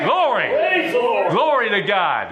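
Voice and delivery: high joyful whoop; hoot and holler. Real, not acted. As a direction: shout praise like at a ball game. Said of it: "hoot and holler".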